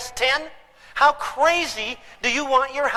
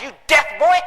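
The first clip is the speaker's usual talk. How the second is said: louder, rising bark of an angry father; angry dad; 4 semitones higher, 5 dB louder than usual. Retold or imitated. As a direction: snap it at the boy. Dad yells, son echoes.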